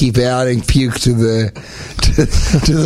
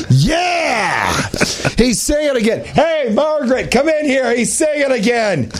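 higher, excited yelling fan; 11 semitones higher than usual. Pretend. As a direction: call out to his wife excitedly. Playing an excited fan at home.

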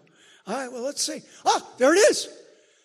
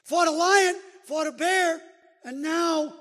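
preaching build; 6 semitones higher, 5 dB louder, slower than usual. Acted. Preaching David's record with rising force.

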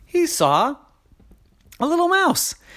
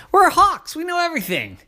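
much higher, loud bragging voice; boastful hawks; 13 semitones higher, 5 dB louder, faster than usual. Acted. Hawks bragging to Ike, high and proud. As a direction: puff up and brag.

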